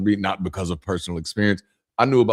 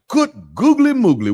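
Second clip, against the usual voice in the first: high, louder hammed-up delight; mock amazement; 13 semitones higher, 5 dB louder than usual. Guest hears lady has moonshine, hammed-up amazement. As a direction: burst out as if hitting the jackpot.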